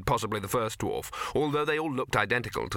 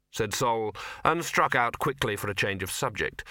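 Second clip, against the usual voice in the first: plain reading voice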